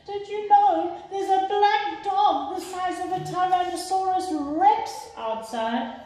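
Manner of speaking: put-on girl alarmed, telling her parents; alarmed child. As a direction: call it out like a scared girl. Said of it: "child voice, alarmed".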